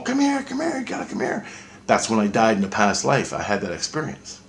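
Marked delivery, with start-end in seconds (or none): 0.0-1.5 very high, airy calling voice that fades to a whisper; friends calling from above. Retold or imitated. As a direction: beckon from far above, then breathe the last words. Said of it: "all your friends are looking in the hole going".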